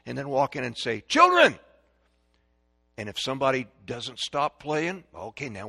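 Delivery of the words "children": sudden high, loud call; calling children. Shown as a demonstration. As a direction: call the kids to instant attention. Parent calling children to attention.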